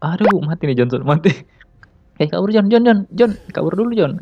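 urgent yelling for John to flee; panicking gamer. Real, not acted. Teammate about to die.

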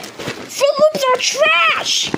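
high, slow drawn-out shout. Acted. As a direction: stretch out every word, yelling. Cereal argument shouting.

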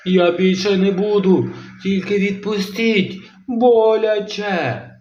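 high begging, drawn-out ouch; pained begging. Acted. Kronosaur begging grandma to let go, high pained plea. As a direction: plead to be let go.

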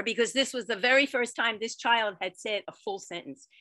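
ordinary delivery

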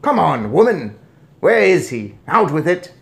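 furious demand. A narrator's reading. Father demands his son from grandmother, shouting angrily. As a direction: press her hard for an answer. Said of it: "like crazy as he spoke".

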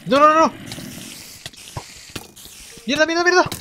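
high, loud frantic cursing; panicked yelling. Real, not acted. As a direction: shout in a panic at the skeleton. Panicked swearing at a skeleton.

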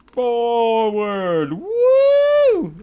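high, stretched word rising into a whoop; gleeful swing call. Real, not acted. Player swinging forward in game, gleeful whoop.

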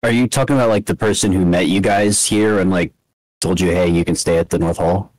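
ordinary delivery

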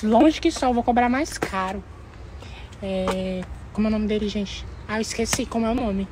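ordinary talk

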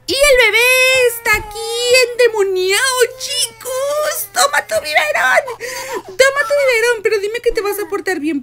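high, loud panicked pleading; panicked yelling. Real, not acted. Bebé endemoniado, grita asustada. (gritos de pánico al bebé)

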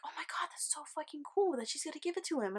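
high, quick, breathy gushing; excited friend. Retold or imitated. Friend reacting to the figurine being handed over, excited gushing. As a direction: gush in a rushed half-whisper.

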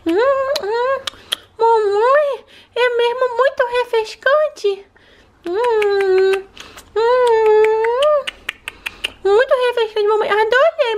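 put-on high childlike voice of a small daughter; little girl voice. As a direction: play the sick little girl talking to mom. Daughter doll drinking juice.

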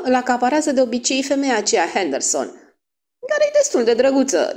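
high, insistent protest; keyed-up young girl; excited girlish complaint. Acted. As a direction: gossip indignantly, high and loud. Excited girls complain of rival.

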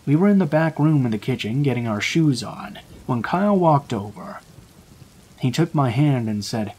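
plain reading voice

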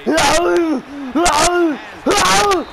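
high, loud crowing, climbing each time; gloating shout. Real, not acted. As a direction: crow it at the opponent. Crowing over a touchdown.

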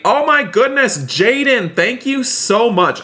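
high, astonished gratitude; excited thanks. Real, not acted. Streamer thanking Jaden for a surprise gift, excited. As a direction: gush in high-pitched surprise, drawing out the thanks.

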